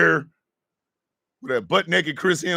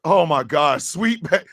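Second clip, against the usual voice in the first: ordinary talk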